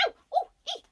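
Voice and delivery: very high, loud yelping cries; pained yelp. Acted. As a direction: yelp like a fox whose paw got bitten. Fox yelps while chased.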